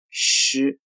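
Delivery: "诗" as the curled-tongue Chinese syllable with a vowel; "诗" retroflex and voiced at the end, unlike English sh; Mandarin shi sound. Shown as a demonstration. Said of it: "不要发成汉语狮子的".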